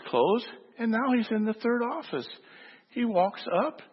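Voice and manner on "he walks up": usual speaking voice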